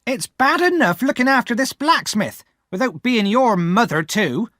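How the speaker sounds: high, loud bitter rant; angry exclaiming. Acted. Sister complaining about Pip and Joe, angry rant. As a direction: vent at the boy and husband. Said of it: "my sister exclaimed".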